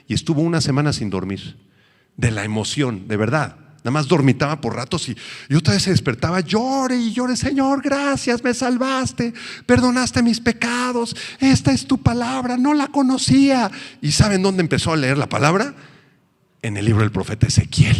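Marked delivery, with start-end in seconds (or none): 5.5-13.7 high, tearful mock joy; a young convert thanking God through tears, pitched high; weeping convert. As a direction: cry out thanks like a new believer.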